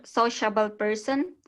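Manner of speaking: ordinary delivery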